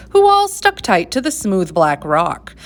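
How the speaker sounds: ordinary delivery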